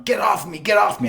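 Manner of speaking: high-pitched panicked bully imitation; strained, high retold yell; mock yelling. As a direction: imitate someone pinned and yelling to be freed. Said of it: "he's yelling".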